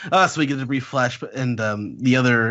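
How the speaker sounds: ordinary delivery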